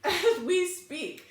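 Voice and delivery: laughing while repeating the phrase; slow amused laugh through words. Real, not acted. Mother laughing at child's remark.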